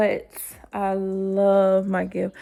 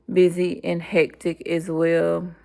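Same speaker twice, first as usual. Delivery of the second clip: ordinary delivery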